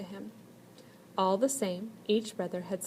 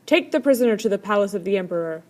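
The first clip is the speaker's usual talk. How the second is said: louder, fast barked order; 9 dB louder, faster than usual. A narrator's reading. Generals order the soldiers to take the prisoner, loud command. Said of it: "they ordered".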